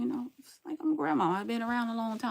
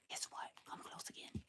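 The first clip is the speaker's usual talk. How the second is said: hushed, breathy whisper into the mic; 22 dB quieter than usual. Real, not acted. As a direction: lean in and whisper it like a secret. Leaning toward camera, secretive.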